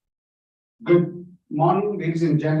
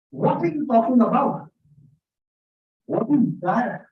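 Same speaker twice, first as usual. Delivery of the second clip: demonstrated Indian questioning; 6 semitones higher than usual. Shown as a demonstration. Speaker demonstrating the gesture to the audience, incredulous. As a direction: act out the puzzled what-gesture. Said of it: "Let me give you an example".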